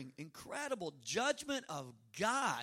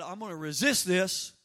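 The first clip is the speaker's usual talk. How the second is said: put-on louder, a boy vowing to fight back; defiant declaration; 7 dB louder than usual. Remembering his boyhood vow against dad, louder and defiant. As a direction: set the jaw and announce it. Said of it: "there came a point where I said".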